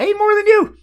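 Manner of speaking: high, loud comic retort; mock-indignant yell. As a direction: fire back in playful outrage. Mock-indignant retort about palate.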